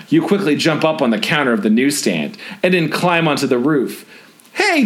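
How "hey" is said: high sharp shout of hey; owner shouts. A narrator's reading. Newsstand owner shouting at kid on roof, high shout. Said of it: "the owner shouts".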